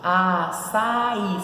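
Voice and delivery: Açaí in three drawn-out parts, a, ça, í; split into syllables. Shown as a demonstration. Teacher showing it splits in three, Açaí in slow syllables. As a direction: separate each syllable of Açaí. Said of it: "separa em três".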